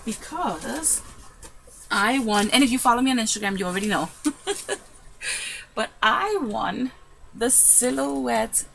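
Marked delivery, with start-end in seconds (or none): 4.1-4.8 short high giggle; happy little laugh; delighted laugh. Real, not acted. Laugh after saying she won.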